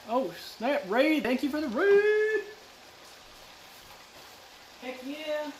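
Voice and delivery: high squeaky excited thanks; cute anime streamer. Real, not acted. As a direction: squeal with delight at the raid.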